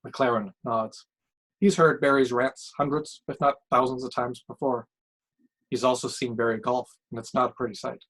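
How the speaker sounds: plain reading voice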